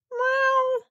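very high thin mew; a tiny kitten mewing; kitten meow. Acted. Kitten mewing from the girl's pocket, very high.

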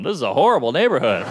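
usual speaking voice

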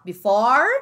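loud, rising "before" with open vowel and R; American R. Shown as a demonstration. Teacher saying look, loud R-ful "before". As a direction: punch "before" up, R fully sounded. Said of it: "som de O e R".

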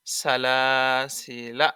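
long, slow stretched vowel; slow Quranic drawl on সালাসিলা; drawn-out reading. Shown as a demonstration. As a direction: stretch the word সালাসিলা out slowly. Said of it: "এক আলিফ মাদে আসলি".

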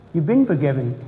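ordinary delivery

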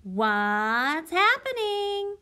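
high, loud, slow, goofy penguin voice; teacher voices the penguin for her class, playful and goofy; Tacky's voice. Acted. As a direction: play a silly cartoon penguin asking. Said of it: "Tacky's famous phrase that he always says".

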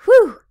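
very loud high whew; big relief after the battle; loud relieved sigh. A narrator's reading.

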